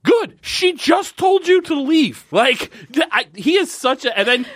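very high, slow incredulous squeal; exasperated rant. Real, not acted. Podcaster answering back a sulky character, exasperated. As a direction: stretch it out in high-pitched exasperation.

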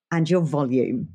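ordinary talk, nothing marked

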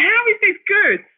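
high, incredulous protest; mock disbelief. Complaining to her therapist, high and incredulous. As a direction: squeal it in exasperated disbelief. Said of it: "I'd be like".

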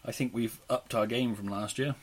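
talking through a chewy mouthful; chewing while talking. Real, not acted. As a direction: mouth full of sweets. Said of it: "Sorry, sorry, I'm chewing up jelly baby".

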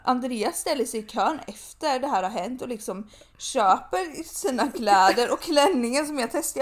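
ordinary delivery

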